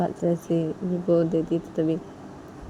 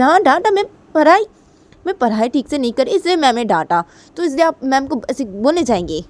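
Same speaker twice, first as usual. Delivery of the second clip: high, loud protest; acted outburst at mum, pitched well up; indignant daughter; 8 semitones higher, 8 dB louder than usual. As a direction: argue back loudly and high. Daughter protests to mother in quarrel.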